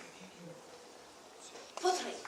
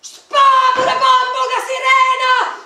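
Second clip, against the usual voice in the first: alarm shout; 8 semitones higher, 16 dB louder than usual. Pretend. Waking the sleeping guards with a loud yell of alarm. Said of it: "Li sveglieremo urlando".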